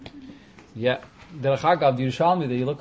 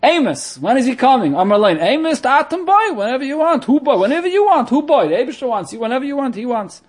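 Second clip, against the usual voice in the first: put-on high, lively Yiddish-accented quote; 8 semitones higher, 6 dB louder than usual. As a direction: act out the sage's reply, pitched high and loud. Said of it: "The Lashon Yerushalmi is".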